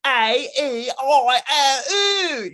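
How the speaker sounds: "A E I O U" high, slow, drawn out with a laugh; mangled Cockney vowels. Retold or imitated. Flower girl repeating the professor's vowels, mangled Cockney. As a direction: echo the vowels badly in a squawky Cockney. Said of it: "you know, like that".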